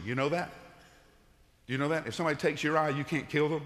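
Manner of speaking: normal talking voice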